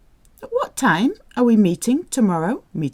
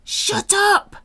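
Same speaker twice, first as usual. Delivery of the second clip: much higher, mock-stern order; mock snapped shut up; 13 semitones higher than usual. Shown as a demonstration. Teacher acting out the English shut up. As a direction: play an irritated scold. Translating the rude command.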